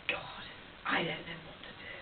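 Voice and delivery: low, hushed, almost whispered; hushed, helpless admission to yourself; quiet despair. Acted. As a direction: say it softly, at a loss. Daughter despairs over mother's care.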